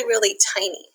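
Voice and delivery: ordinary delivery, nothing marked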